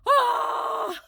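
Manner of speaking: high, loud excited shriek; fangirl squeal. Retold or imitated. Fan meets her favourite author and shrieks. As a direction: let out a thrilled fan scream. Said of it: "I'm like".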